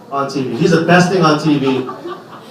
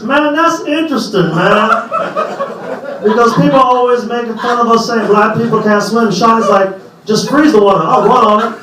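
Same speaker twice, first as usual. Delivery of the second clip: wander off topic like a pundit; Barkley impression; 8 semitones higher than usual. Barkley commentating again.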